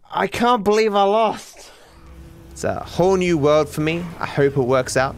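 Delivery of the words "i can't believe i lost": higher, drawn-out cry of disbelief; incredulous, slow high protest; dismayed outcry. Real, not acted. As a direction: wail at a sudden loss. Cries out after losing in game.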